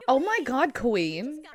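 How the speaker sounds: ordinary talk, nothing marked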